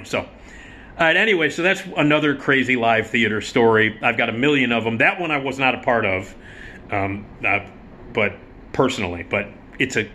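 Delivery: ordinary delivery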